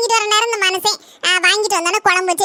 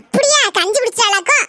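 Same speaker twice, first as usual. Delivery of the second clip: surprised; 4 semitones higher than usual. Acted. Reacts to gossip.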